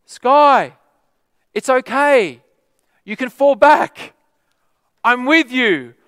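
high, loud call across a big hall. Retold or imitated. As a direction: shout encouragement from far away. Leader calling from back of hall.

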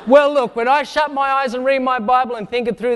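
ordinary talk, nothing marked

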